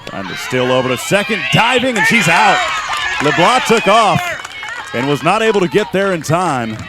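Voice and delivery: urgent live call of a caught runner; sportscaster calling a close play, voice leaping up; excited play-by-play. Real, not acted. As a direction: jump high and loud at the out.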